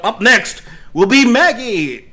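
high, loud showy host call; contest announcer. Acted. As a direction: announce her like a star. Announcer introduces next contestant.

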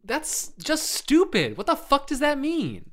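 exasperated. Real, not acted. Scoffing at the article.

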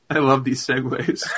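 laughing through the words; real amused laugh. Real, not acted. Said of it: "laughing at segue".

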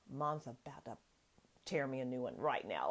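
low, quiet warning under the breath; mock hushed dread. Kids bracing for trouble from mom, hushed mock dread. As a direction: imitate kids warning each other in low voices.

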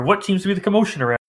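put-on high, fast old man voice; quick doddering old man; elderly voice. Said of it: "In an elderly voice".

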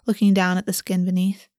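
plain reading voice

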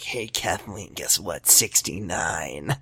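whispered low and slow, seductive; sultry whisper. Retold or imitated. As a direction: breathe it like a come-on. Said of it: "he's like".

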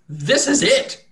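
high, loud insistence; emphatic farmer. Acted. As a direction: stress this is the only time.

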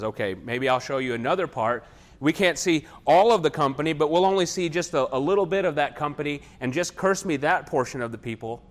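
a frustrated king pressing his demand; mad king. Retold or imitated. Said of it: "Balak gets mad at him".

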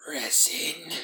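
whispered croak, rising at the end; croaking creature. A narrator's reading. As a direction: croak it out like a lurking monster. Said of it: "the voice croaked".

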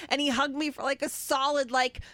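ordinary talk, nothing marked

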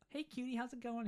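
high, soft cutesy greeting; fake flirty. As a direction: coo at a pretend sweetheart.

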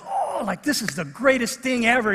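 high, excited imitation of a thrilled boy; fired up. Son thrilled by street outreach, fired up. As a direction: gush like a kid having the best night. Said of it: "He's, like, so fired up".

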